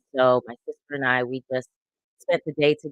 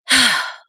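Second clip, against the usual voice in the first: loud shaky exhale; nervous sigh; 9 semitones higher, 8 dB louder than usual. Real, not acted. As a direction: blow out a nervous breath. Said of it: "let me take a breath 'cause what y'all seeing right now is the nerves".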